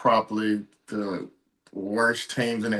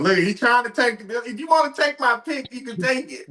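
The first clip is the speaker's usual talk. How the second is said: mocking high voice; 11 semitones higher than usual. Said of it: "look at him".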